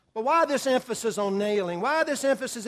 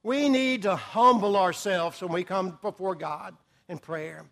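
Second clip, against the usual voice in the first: ordinary delivery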